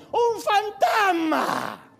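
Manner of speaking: high, slow, drawn-out scream of fright; terrified scream. Retold or imitated. Disciples shrieking at a ghost on the sea, high and drawn out. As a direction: shriek it like you've seen a ghost. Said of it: "relata que gritaron".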